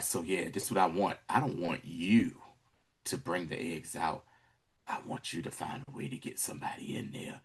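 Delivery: low, hushed orders; imitating a villain, soft and deep; quiet menace. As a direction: give instructions almost under your breath. Retold villain gives quiet orders.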